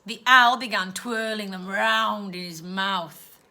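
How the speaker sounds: plain reading voice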